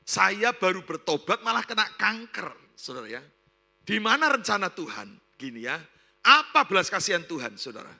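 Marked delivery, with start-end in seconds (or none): 0.0-2.7 loud, high, slow lament; protesting to God. Retold or imitated. Newly repentant woman with cancer questions God, loud acted protest. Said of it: "dia berkata". 3.9-5.1 loud, high questioning cry; protesting to God. Retold or imitated. Sick woman questions God's plan, loud acted protest. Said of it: "dia berkata". 6.3-7.5 very loud, raised acted lament; protesting to God. Sick woman questions God's mercy, loud acted protest. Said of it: "dia berkata".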